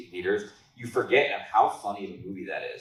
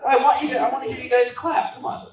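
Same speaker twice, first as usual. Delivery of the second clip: hype voice; 5 dB louder than usual. Real, not acted. Hyping up the audience.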